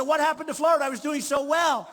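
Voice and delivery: hammed-up dismay over a poll; mock alarm. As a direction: play up the shock at the headline. Retelling his shocked reaction.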